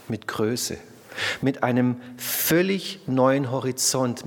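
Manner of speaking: ordinary talk, nothing marked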